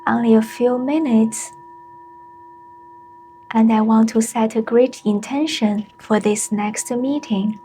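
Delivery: unhurried meditation guide with long pauses; spacious, measured mindfulness voice; guided meditation. Acted. Leads a short mindfulness meditation.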